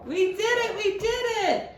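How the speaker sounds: high, bright cheer; celebrating. Acted. Librarian cheering with the child, high. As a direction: cheer the win.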